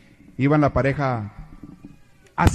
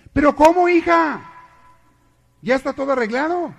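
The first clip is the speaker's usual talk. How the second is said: much higher, loud cry of a startled mother; shocked mother; 11 semitones higher, 7 dB louder than usual. Acted. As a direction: gasp it out as a stunned mom.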